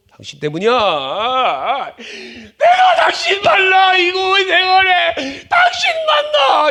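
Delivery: high, loud whining blame; resentful spouse. Acted. Preacher voicing a spouse blaming their partner, shrill shouted complaint. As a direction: shriek the blame at your partner.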